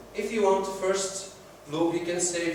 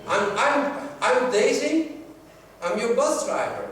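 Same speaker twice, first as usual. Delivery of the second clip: friendly bus driver; 6 semitones higher, 5 dB louder, slower than usual. Retold or imitated. Bus driver introduces herself.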